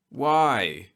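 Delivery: usual speaking voice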